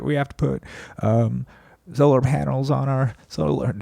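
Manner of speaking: a genteel Southern president voice that slides toward Clinton; mock folksy Southern politician; Carter impression. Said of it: "is that Clinton?".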